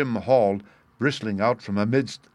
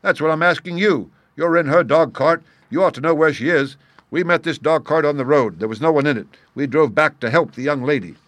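loud, high agitated protest; 5 semitones higher, 5 dB louder than usual. A narrator's reading. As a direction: insist in alarm. Stranger agitated answering Holmes.

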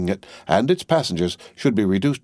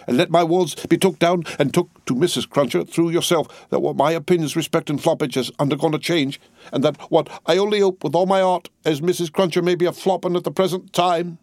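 preaching; 10 semitones higher than usual. A narrator's reading. Cruncher sermonizes to Miss Pross, pulpit style. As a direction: hold forth like a preacher. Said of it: "hold forth as from a pulpit".

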